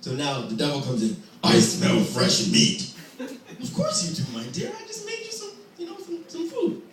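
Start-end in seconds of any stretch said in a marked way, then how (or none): 1.4-2.9 very low, slow, growled line; a menacing devil. Acted. Devil coming home, low slow menace. 3.2-6.8 sweet wife. Acted. Devil's wife soothing the devil, high and sugary.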